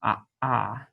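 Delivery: "ah, ah" cut by a catch in the throat; glottal stop demo. Shown as a demonstration. Teacher to students, showing the aleph as a glottal stop. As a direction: stop the breath in the throat between "ah" and "ah". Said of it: "a stop in the throat like that".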